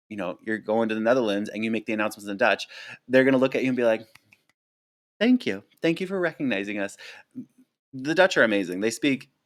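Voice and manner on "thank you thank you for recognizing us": grateful passenger. Retold or imitated. Dutch passengers thanking the purser.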